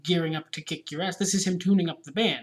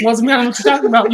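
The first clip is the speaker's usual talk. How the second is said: a louder, hammed-up wasn't me; 4 semitones higher, 10 dB louder, much faster than usual. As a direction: brush it off like the song.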